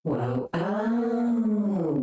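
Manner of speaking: ordinary talk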